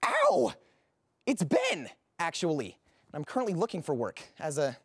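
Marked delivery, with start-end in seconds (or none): 0.0-1.9 high yelp, then a pained correction. Acted. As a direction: cry out, then insist on the name. Hurt, corrects his wrong name.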